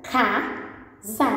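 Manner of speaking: "casa" split as ca, pause, za; teacher joining ca and za in "casa"; syllable by syllable. Shown as a demonstration. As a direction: sound out "casa" in two separate syllables. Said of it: "nós temos a sílaba za".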